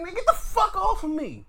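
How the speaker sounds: high, loud shove-off yell; imitating Punk yelling get off me; mock angry shout. Punk shoving Gunther away, mock shout. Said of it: "And he's like".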